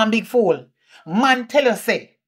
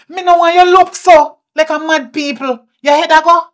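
loud, high furious scolding; a mother yelling at her grown daughter; angry shout; 8 semitones higher, 9 dB louder than usual. Acted. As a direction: blast her for acting crazy.

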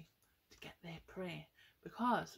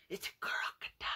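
spooky whisper; faster than usual. Acted. Scary crocodile reveal.

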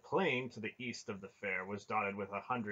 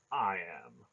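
usual speaking voice

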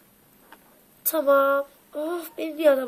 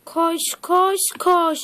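drawn-out cheer; 4 semitones higher, 3 dB louder, much slower than usual. Pretend. Cheering a partner in a game.

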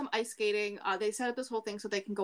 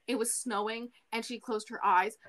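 normal talking voice

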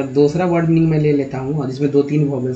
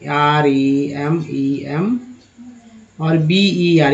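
remember spelled letter by letter, very slowly; slow spelling; much slower than usual. Shown as a demonstration. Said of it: "एक word ले लीजिए remember का".

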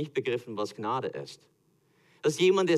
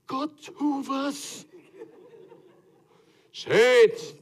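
much higher, slow, laughing, then a loud cry; mock desperate plea; 12 semitones higher, 5 dB louder, much slower than usual. Moses turning to God, hammed-up panicked plea. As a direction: beg God in comic panic.

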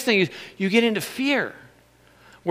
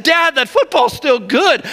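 son's pleading; 8 semitones higher, 4 dB louder than usual. Retold or imitated. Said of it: "He said".

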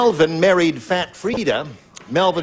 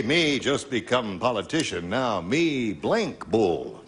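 lower, softer pidgin chief, slowing right down; Sitting Bull; 6 semitones lower, 6 dB quieter, slower than usual. Acted. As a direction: lower the voice and stretch out the last words. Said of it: "Sitting Bull say".

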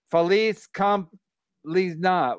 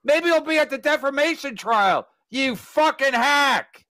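mocking taunt; 10 semitones higher than usual. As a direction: needle him in a put-on heckler voice.

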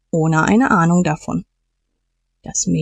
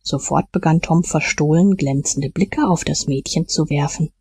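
ordinary delivery

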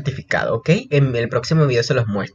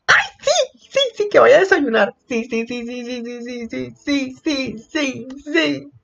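giddy squeals; 10 semitones higher, slower than usual. Real, not acted. Fan overjoyed by the scene, high squeaky repeated yes.

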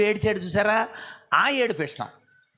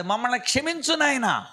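higher, pleading cry for mercy; pleading for forgiveness; 6 semitones higher than usual. Shown as a demonstration. Preacher models a plea to God, pleading. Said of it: "ఉపవాసం అంటే అది కాదు".

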